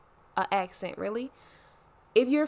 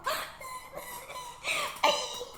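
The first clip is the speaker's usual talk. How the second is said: high laugh; 6 dB quieter than usual. Real, not acted. Laugh after club impression.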